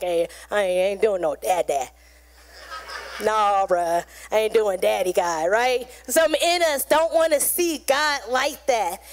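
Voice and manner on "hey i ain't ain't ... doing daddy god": lightly voiced low-pitched grown man; a low, lightly mocked refusal; reluctant grown man. Grown man refusing to say daddy God, lightly mocked reluctance. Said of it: "some of the grown men like".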